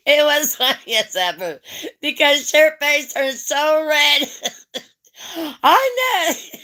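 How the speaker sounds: high, loud, laughing through the words; real laughter. Real, not acted. Telling friend her face turned red, laughing. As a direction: crack up while telling it.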